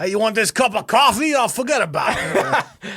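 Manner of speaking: big, loud, high-pitched New York accent. Shown as a demonstration. Co-host takes his turn at New York, exaggerated New York accent. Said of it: "Do a New York accent".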